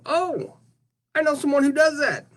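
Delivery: high-pitched, excited search-engine character voice; Google. Acted. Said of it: "Google will go".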